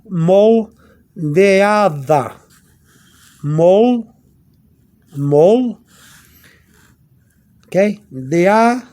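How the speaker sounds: "Moldeada" pulled apart into "mol" and "deada", D as th; TH sound demo. Shown as a demonstration. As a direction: sound out "Moldeada" in drawn-out pieces. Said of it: "You see a T H sound?".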